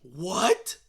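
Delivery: loud, stunned outburst; mock shock. Fan reacting to sequel news, loud mock shock. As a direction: blurt it out in exaggerated disbelief.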